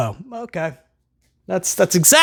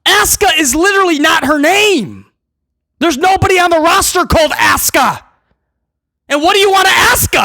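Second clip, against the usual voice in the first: indignant shout; 9 semitones higher than usual. Real, not acted. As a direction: yell the pun in disbelief. Ranting about the name.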